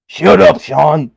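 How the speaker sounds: loud, high shut-down; mock snapped retort. Boss voice shutting down an executive, loud and high. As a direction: cut him off hard.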